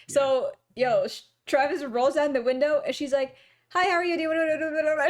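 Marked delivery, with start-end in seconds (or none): 3.7-5.0 rapid-fire, higher-pitched greeting; rushed, run-together sales patter; fast talker. Retold or imitated. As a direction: imitate a car wash girl rattling it off. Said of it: "talking so fast".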